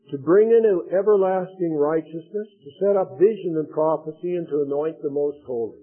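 steady, solemn Bible reading; scripture reading to a congregation. Acted. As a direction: read the angel's message with weight. Reads the seventy weeks prophecy.

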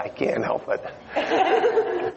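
high laughing squeal. Real, not acted. Preacher asking an old woman's age, laughing. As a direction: laugh through the words.

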